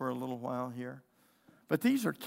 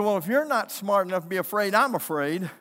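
raised, louder protest of a scared son; frightened boy; 6 semitones higher, 7 dB louder than usual. Retold or imitated. Ten-year-old son tells his dad he's scared, mock frightened protest. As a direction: imitate a worked-up, frightened child. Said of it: "And he started shaking".

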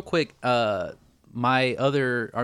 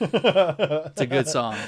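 drawn-out enthusing; 4 semitones higher, 6 dB louder, much slower than usual. Real, not acted. Slow sung-out praise.

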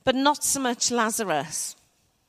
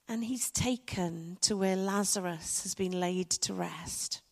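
ordinary talk, nothing marked

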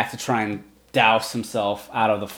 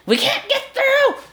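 very high, slow squeaky goblin cry. Acted. Goblin stuck behind the door complains, squeaky and very high. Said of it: "He's like".

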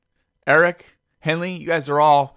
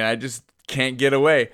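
usual speaking voice

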